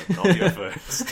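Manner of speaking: breaking into laughter, slow and loud; laughing. Real, not acted. The friend jokes as the Queen, laughing loudly and slowly. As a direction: laugh out the punchline.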